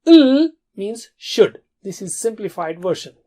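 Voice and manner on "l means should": slow, loud, spaced out for memory; emphatic. Shown as a demonstration. Teacher stressing the key rule to remember. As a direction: drill it in word by word. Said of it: "Remember that".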